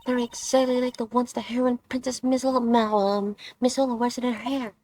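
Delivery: put-on bubbly teen heroine, eager to go; excited girl voice. Streamer voices Kay in game.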